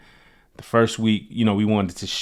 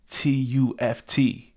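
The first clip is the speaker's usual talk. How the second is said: slow, higher-pitched letter-by-letter spelling; 5 semitones higher, much slower than usual. Retold or imitated. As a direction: spell it out slowly, one letter at a time. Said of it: "He spells it out".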